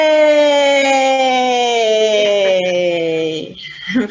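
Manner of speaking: long loud laugh. Acted. Laughter exercise.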